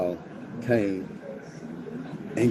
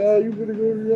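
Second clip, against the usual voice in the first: high, tearful mock plea from mom; imitating crying mom; 11 semitones higher than usual. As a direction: squeeze out mom's crying voice, half-acted. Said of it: "Mom said she started crying".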